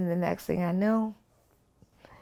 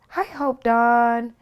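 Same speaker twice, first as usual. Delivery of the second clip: slow, high sing-song greeting, louder; Michael's sing-song; 6 semitones higher, 6 dB louder, much slower than usual. Retold or imitated. Michael answering her phone call, slow and sing-song. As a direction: stretch the name out like a little tune. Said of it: "has a way of sing-songing".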